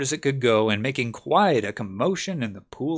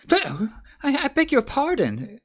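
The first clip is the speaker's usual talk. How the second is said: high, flustered apology; hasty apology; 3 dB louder than usual. Acted. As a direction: blurt out a quick sorry. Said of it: "cried Alice hastily".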